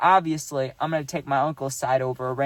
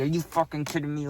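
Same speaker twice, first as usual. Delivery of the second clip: imitating the uncle, softer, through gritted teeth; gritted angry mumble; 5 dB quieter than usual. Uncle in the stranger's face at the bar, gritted angry mumble. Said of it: "Like, like that".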